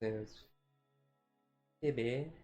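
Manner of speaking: normal talking voice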